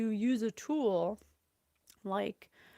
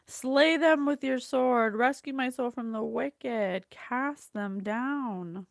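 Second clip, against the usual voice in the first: louder, commanding prayer with a slow close; forceful prayer reading; 2 semitones higher, 6 dB louder, slower than usual. Acted. Reading the psalm's call for rescue, forceful prayer. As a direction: proclaim it like a battle cry.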